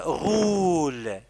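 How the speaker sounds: stretched teaching voice; slow dictation. Shown as a demonstration. Teacher dictating "roule" to the pupil, slowly and deliberately. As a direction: say "roule" very slowly, holding the vowel.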